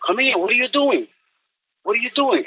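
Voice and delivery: high-pitched retold call to the dog; mock calling voice, high but not yelled. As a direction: question the dog as you call it, pitch lifted.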